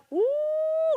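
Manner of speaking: very high, held mock whoop; mother's mock American cry. Mother sees her child's drawing, high imitated American whoop. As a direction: let out a long high "wuh". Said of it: "dengan nada orang kulit hitam, dia berteriak".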